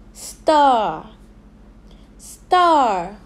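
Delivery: Car, jar, far, star, tar ending in long open "ah"; isolated model words, each twice, with long pauses; repeat-after-me drill. Shown as a demonstration. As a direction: draw out each word's final "ah", falling. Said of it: "Repeat after me".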